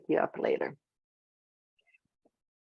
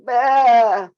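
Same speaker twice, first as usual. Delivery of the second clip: long, loud, high "aah"; mock panicked yell; 5 semitones higher, 11 dB louder than usual. Presenter showing panic at reading notes, mock panicked yell. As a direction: blurt out a drawn-out overwhelmed cry. Mimics panic at having to read.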